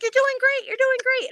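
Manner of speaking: high, loud cheer from a course official; cheering encouragement. Retold or imitated. Officer cheering on a passing runner, high excited cheer. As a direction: call out high-pitched encouragement.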